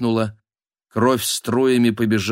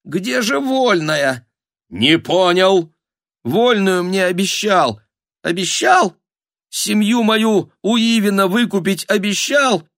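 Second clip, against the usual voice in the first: indignant demand; 10 semitones higher, 3 dB louder than usual. Acted. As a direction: demand what was promised, voice climbing. Обманутый герой требует обещанного. (возмущённый крик с упрёком)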